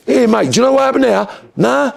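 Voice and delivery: high, fast nosy whisperer; mock Londoner gossip. Imitating a London crook asking around, high and fast. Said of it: "that's what they do".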